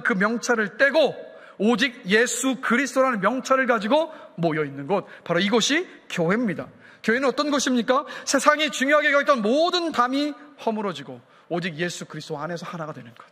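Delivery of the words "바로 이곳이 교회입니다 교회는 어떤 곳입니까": usual speaking voice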